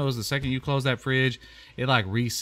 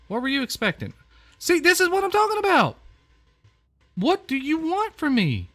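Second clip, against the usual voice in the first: much higher, louder, fast outburst; exasperated shout; 4 dB louder than usual. Real, not acted. Fed-up gamer yells at the picky guest, exasperated. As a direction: throw up your hands and yell it.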